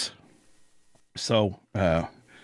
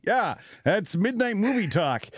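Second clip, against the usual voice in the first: usual speaking voice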